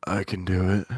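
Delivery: low cocky boast; tough guy voice. Retold or imitated. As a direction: drop low and brag. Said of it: "and he's like".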